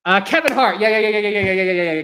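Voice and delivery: loud burst of rapid yeahs; thrilled to have remembered; sudden excited recall. Real, not acted. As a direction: blurt it out as the name comes back. Suddenly remembers the forgotten name.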